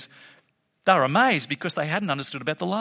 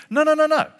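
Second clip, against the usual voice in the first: high, insistent string of no's; emphatic no; 9 semitones higher, 4 dB louder than usual. Real, not acted. To the congregation, correcting them, insistent no. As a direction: wave the idea off, pitched up.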